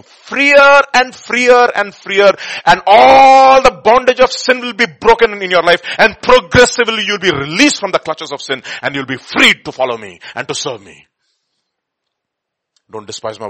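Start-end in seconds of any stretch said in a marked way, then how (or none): 0.0-8.3 preaching fervor. Acted. Preaching climax, voicing Jesus.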